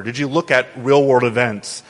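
normal talking voice

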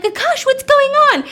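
very high, loud alarmed call; overacted alarm. Doctor bursts through the door, loud and alarmed. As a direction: burst in shouting his name. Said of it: "that was really a lot of, you know, acting".

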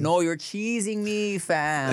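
high, slow Toronto slang protest; Toronto accent. Shown as a demonstration. Correcting a confused friend's reading of slang, put-on Toronto accent. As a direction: stretch the complaint in a Toronto accent.

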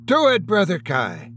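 sharp, high, urgent snap; snapping. A narrator's reading. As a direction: snap the order at him. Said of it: "Andrew Miller snapped".

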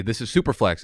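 ordinary talk, nothing marked

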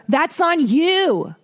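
high, slow, drawn-out put-down again; mock scolding. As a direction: lean on you, slow and high. Repeats the scolding.

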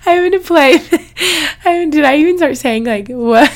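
talking through giggles; laughing. Real, not acted. Still laughing after wife joke.

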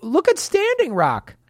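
excited outburst. Real, not acted. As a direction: blurt it out all fired up. Sudden outraged exclamation.

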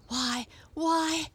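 high surprised exclamation from a cat; exclaiming cat. A narrator's reading.

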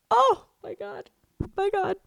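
very high, thin squeak; overcome, tearful. Real, not acted. Reader overcome by her book notes, tearful squeak. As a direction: squeak it out as if about to cry.

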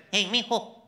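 put-on light Mexican accent, proud dad. Mexican father praises his son's report card, in a light Mexican accent. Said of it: "I'm like".

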